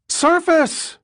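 screamed order. Acted. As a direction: scream it as the boat tumbles. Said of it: "Condon's voice rose to a scream".

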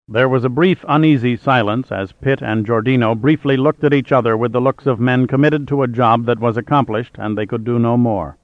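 ordinary delivery, nothing marked

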